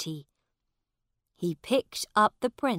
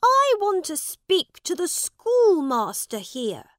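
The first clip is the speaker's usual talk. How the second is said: put-on proud young prince; 12 semitones higher, 10 dB louder than usual. Boy claims to be the prince.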